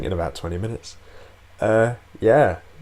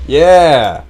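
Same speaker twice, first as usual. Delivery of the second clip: triumphant yell; 7 semitones higher, 15 dB louder than usual. Real, not acted. Celebrating a win in game.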